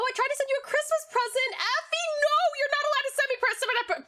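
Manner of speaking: high excited mock scolding; squealing no, you shouldn't have; playful protest. Real, not acted. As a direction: fuss at a friend for a gift. Scolding viewer about present.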